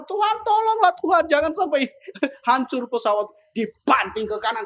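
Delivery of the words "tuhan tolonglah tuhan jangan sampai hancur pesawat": high-pitched mock-desperate prayer; panicked plea. Retelling his prayer to God in turbulence, panicked plea. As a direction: beg for rescue, breaking into a chuckle.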